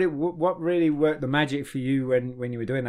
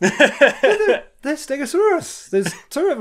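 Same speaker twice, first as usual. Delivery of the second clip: laughing; 13 semitones higher, 6 dB louder, slower than usual. Retold or imitated. Retold exasperated correction.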